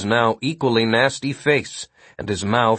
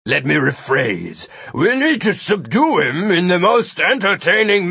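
monster voice; 2 semitones higher than usual. A narrator's reading. Gilbert speaks in dialogue.

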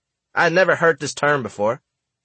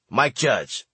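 ordinary delivery, nothing marked

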